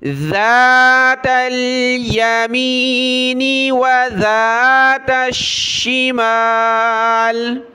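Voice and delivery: ज़ातल with tongue out on ذ, ت joined to ل; slow chant of ज़ातल यमीनी व ज़ातश शिमाल; tajweed recitation. Shown as a demonstration. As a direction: recite slowly, ذ between the teeth. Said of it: "जाल पर ज़बान निकालें".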